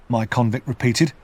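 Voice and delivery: plain reading voice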